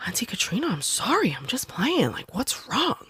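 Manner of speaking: put-on high, soft little-kid voice; six-year-old nephew. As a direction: imitate a small, apologetic child. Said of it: "he was like".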